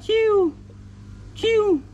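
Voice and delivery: high squeaky whoosh for the cast; mouth sound effect. Acted. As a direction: zip the line out with a high "chiu". Toy fishing, casting sound. (tiếng vút cao)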